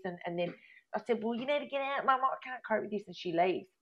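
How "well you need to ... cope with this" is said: higher-pitched, exasperated dismissal; fed up, pitched up, reliving the row; retold argument. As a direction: re-enact ordering mum out, without shouting. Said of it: "my mum and I have this big argument".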